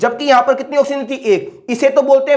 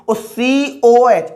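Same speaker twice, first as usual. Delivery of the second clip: drawn-out dictation; much slower than usual. Shown as a demonstration. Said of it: "teacher drawing out formula letters".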